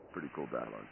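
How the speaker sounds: quiet echo. Real, not acted. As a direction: murmur it to yourself.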